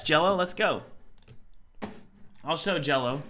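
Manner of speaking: ordinary talk, nothing marked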